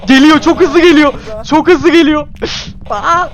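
high, loud terrified shouting; panicked screaming. Real, not acted. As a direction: yell in real panic as it charges. Ghost hunting, ghost rushing at them. (paniğe kapılmış çığlık)

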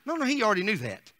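ordinary talk

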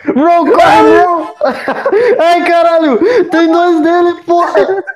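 high, loud, laughing panic; panicked screaming. Real, not acted. Two monsters appear.

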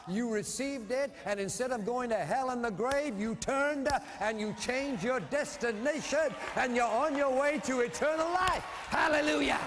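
rising into a shouted hallelujah; fiery preacher climax; preaching at full force. Acted. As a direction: build the preaching to a loud shout.

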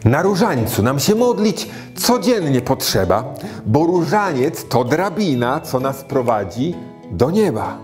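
put-on rhythmic rhyming verse for children; poem recitation. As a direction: recite it like a nursery rhyme.

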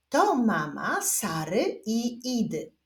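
slow word-by-word reading; measured beginner's reading with spaced words; reading demonstration. Shown as a demonstration. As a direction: read aloud slowly for learners to follow. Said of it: "zapraszam Was do odczytywania zdań".

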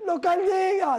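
high-pitched insistence as the gringo; mock high dismissive line; imitated gringo. Voicing the gringo's refusal, insisting.

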